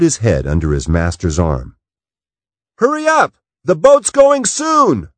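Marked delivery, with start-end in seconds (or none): 2.8-5.1 high, loud call to hurry someone along. Acted. Matt calls Scott from the cabin door to the boat, a high shout. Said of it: "Matt called".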